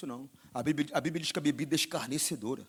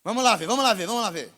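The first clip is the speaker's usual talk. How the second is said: louder, higher alarmed stingy husband; mock panic at a thousand reais; startled stingy husband; 5 semitones higher, 9 dB louder than usual. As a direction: react like a miser hearing the price.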